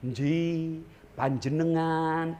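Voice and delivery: bow your words like a polite Solo Javanese; gently imitating a refined Solo Javanese speaker; Solo Javanese accent. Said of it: "Kalau orang Solo".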